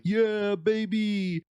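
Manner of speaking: put-on quick high "Yeah, baby"; light take on a streamer's "Yeah, baby"; Moist Critical meme. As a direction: throw out the catchphrase like a streamer. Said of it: "Moist Critical saying".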